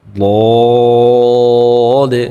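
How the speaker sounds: বদে held long and thick from the throat; heavy throaty Dad. Shown as a demonstration. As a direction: fill the mouth and draw বদে out. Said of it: "মোটা করে গলা থেকে". (গলা থেকে মোটা করে বদে)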